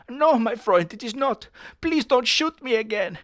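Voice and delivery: high, loud hammed-up German accent; mock German. Hitler pleading not to be shot, high German accent.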